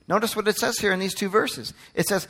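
ordinary talk, nothing marked

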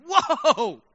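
high, drawn-out whoa of surprise; shocked. Retold or imitated. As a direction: act out being taken aback. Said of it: "I'm shocked".

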